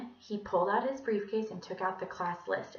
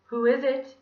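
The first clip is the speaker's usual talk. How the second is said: lightly voiced Scottish gentleman, raised; 5 semitones higher, 9 dB louder than usual. A narrator's reading. Villain answering a knock in disguise, Scottish. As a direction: call out politely with a Scottish lilt. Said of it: "he asked in his Scottish voice".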